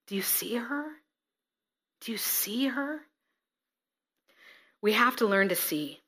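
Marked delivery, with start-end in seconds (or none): 0.1-3.0 soft, breathy whispered question; whispered plea. Acted. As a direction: whisper it to open his eyes. Jesus pleading with Simon.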